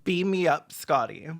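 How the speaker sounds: normal talking voice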